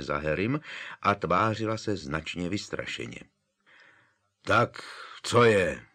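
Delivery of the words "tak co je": louder gruff demand; grumpy. A narrator's reading. Hagrid gruffly asks the seated trio what they want. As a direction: bark the question crossly. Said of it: "zeptal se nevrle Hagrid".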